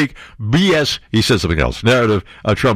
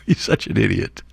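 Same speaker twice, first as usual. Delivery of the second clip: ordinary talk, nothing marked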